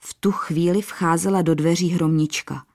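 usual speaking voice

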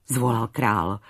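ordinary delivery, nothing marked

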